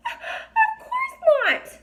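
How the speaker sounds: very high, slow, sobbing fairy voice; tiny fairy sobbing in protest; crying fairy. A narrator's reading. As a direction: wail it through tears like a tiny fairy. Fairy crying over her trial.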